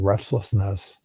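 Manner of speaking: ordinary delivery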